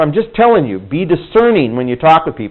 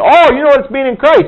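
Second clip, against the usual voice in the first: much higher pitched, confident, a touch naive; breezy assumption; 10 semitones higher than usual. Retold or imitated. Preacher recalls assuming people understand, higher and breezy. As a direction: sound sure of yourself.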